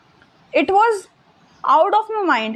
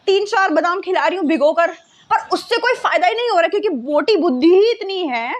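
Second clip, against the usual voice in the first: normal talking voice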